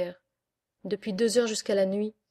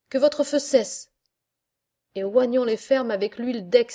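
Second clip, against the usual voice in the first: loud order to the crew; commanding shout; 2 semitones higher, 5 dB louder than usual. Acted. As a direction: bark the order over the guns. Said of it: "crie-t-il enfin".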